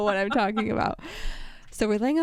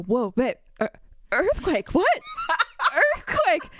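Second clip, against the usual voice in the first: mock earthquake alarm; 6 semitones higher than usual. Imitating partner feigning earthquake.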